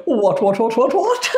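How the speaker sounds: high, fussy "what, what"; blustering old English gent; posh toff. Retold or imitated. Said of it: "So we go".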